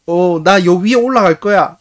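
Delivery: 높고 큰 아이 목소리; 고집스럽게 선언하듯 띄워 말하기. Retold or imitated. 아이가 올라간다고 선언. (씩씩한 꼬마 말투)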